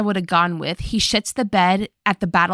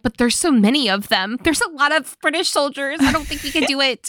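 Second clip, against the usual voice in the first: whiny reluctant general; 6 semitones higher than usual. Acted. Acts out reluctant General Lee.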